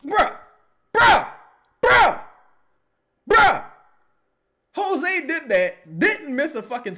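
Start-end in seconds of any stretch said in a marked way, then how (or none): 0.1-3.6 exasperated shout. Real, not acted. Reacting to bad play live.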